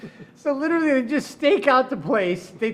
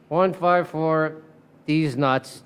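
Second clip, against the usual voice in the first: reading it slowly and flatly; slow, reluctant reading; slow deadpan read; 5 semitones lower, much slower than usual. Retold or imitated. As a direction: spell it out like reading a report. Cop reading email aloud.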